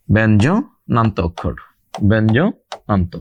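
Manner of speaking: ব্যঞ্জনান্ত drawn out slowly in parts; slow term repetition. Real, not acted. Teacher repeats a new term for students, slowly and broken up. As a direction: say ব্যঞ্জনান্ত slowly, pausing inside the word.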